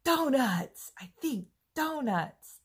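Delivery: high and loud, gushing over donuts; a big high-pitched yearning "donuts"; telling her coworker what carbs mean to her, loud excited craving. Retold or imitated. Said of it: "And I'm like".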